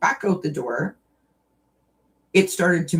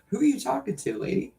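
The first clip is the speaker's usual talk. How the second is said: lightly voiced puzzled cat, higher and softer; imagined cat reply; 8 semitones higher, 4 dB quieter, faster than usual. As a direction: imitate a cat questioning its owner, lightly. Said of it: "They're like".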